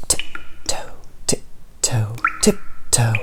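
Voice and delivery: soft secret whisper; tiptoe whisper. A narrator's reading. Creeping into the gloomy cave, hushed whisper.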